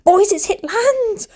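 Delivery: high-pitched, stretched-out call; re-enacted rising cry to the boys; excited announcement. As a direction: call out the storm news giddily. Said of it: "I'm like".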